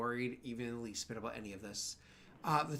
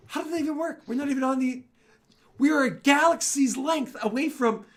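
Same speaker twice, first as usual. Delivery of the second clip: baffled outburst; 13 semitones higher, 13 dB louder than usual. Acted. Solarian baffled after deal collapses.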